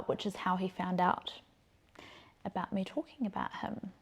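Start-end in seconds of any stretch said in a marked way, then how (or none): none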